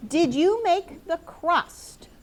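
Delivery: Did you make the crust read slow, high and loud; slow emphatic question read aloud. Shown as a demonstration. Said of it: "teacher reads example sentence".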